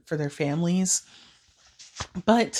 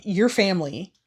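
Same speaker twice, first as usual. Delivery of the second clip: usual speaking voice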